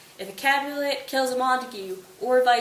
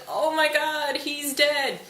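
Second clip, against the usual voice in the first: high-pitched hammed-up wail; mock wailing; 6 semitones higher, much slower than usual. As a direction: imitate a wailing nurse, voice raised high. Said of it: "she's wailing".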